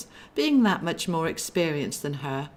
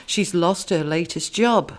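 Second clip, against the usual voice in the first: plain reading voice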